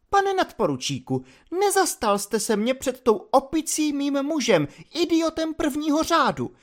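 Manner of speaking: high indignant lady's voice, reproachful; offended lady's letter. Acted. Katy's letter reproaching the lieutenant, in a high indignant voice. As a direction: voice the wronged wife scolding the lieutenant in a high tone.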